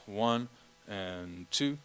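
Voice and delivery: ordinary talk, nothing marked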